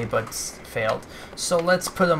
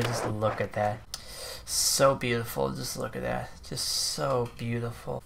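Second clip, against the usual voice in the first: soft admiration; 8 dB quieter than usual. Real, not acted. Admiring replay softly.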